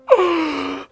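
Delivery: sharp, high gasp of wonder; excited gasp. Retold or imitated. Groom seeing his bride, sharp excited gasp. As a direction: gasp like seeing her walk in.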